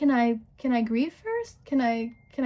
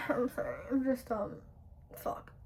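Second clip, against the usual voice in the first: very soft, halting, real upset; choked up; 10 dB quieter than usual. Real, not acted. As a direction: sigh and struggle to get the words out. Breaking down while talking about grandma's death.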